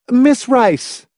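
high, penetrating woman's call; urgent call. A narrator's reading. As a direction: cut in and call her back urgently. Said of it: "in an urgent, penetrating voice".

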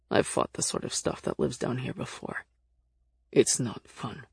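low, quiet character voice, grim; low-pitched reluctant fighter; gruff-tempered Nick figure. Acted. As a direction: speak low and softly, warning from experience.

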